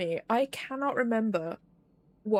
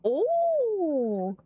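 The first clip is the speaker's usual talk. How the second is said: delighted ooh; 8 semitones higher, 3 dB louder than usual. Real, not acted. Delighted at chocolate dimes.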